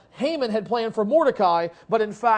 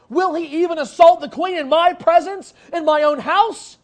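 outraged shout, higher and louder; a king bellowing at the man on his queen's couch; 7 semitones higher, 7 dB louder than usual. Acted. The king, finding Haman on the queen's couch, shouting in outrage.

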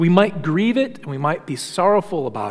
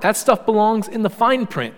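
ordinary talk

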